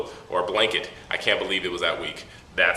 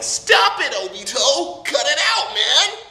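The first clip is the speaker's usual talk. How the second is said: high, loud mock-desperate yell; pleading shout; 6 dB louder, slower than usual. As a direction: yell it like a frantic cartoon hero. Voices Naruto's dad pleading.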